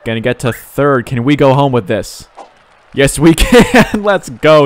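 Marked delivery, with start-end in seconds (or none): 2.9-4.7 laughing cheer. Real, not acted. Celebrating the run scoring.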